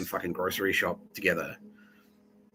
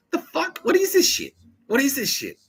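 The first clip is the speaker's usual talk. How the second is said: very high, incredulous squawk; exasperated, hammed-up outburst; outraged disbelief; 5 dB louder than usual. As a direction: replay your bewildered outrage in a high-pitched voice. Acting own outraged reaction.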